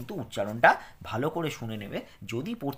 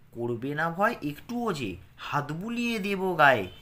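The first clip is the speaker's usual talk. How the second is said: put-on lilting, rhythmic poem reading for children; expressive recital for a class; poem recitation; 4 semitones higher, 4 dB louder, slower than usual. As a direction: recite it with a gentle sing-song beat.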